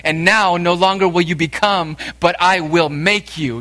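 preaching loud and high, building fervor; impassioned preaching. Acted. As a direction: press the call home to the congregation. Preaching at full force on Jesus' call.

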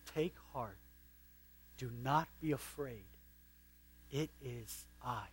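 Jesus gently calming the disciples; gentle hush. Acted. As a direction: softly reassure the terrified men.

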